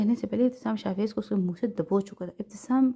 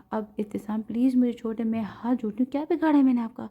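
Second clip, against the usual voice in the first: ordinary delivery, nothing marked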